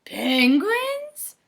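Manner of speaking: high, loud squeak of surprise; surprised. Acted. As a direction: act amazed, pitch way up. Tacky's surprised question.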